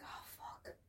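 hushed, voiceless curse; whispered dismay. Retold or imitated. Comic reliving an onstage slip, whispered dismay. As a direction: curse under your breath as it sinks in. Retelling horrified realisation on stage.

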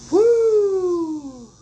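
high, drawn-out "woo"; long whoop. Real, not acted. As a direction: let out a long falling whoop. Exclaims after her own warning.

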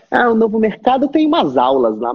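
lightly put-on dismissive complaint; departing student. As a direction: imitate a student making excuses. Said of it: "e ele vai embora e fala".

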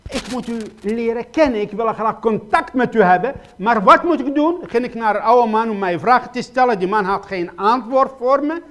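loud, urgent pleading sermon voice; preacher crying out his questions to the congregation, loud and pleading; full-force preaching. Acted. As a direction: hammer each question home from the pulpit.

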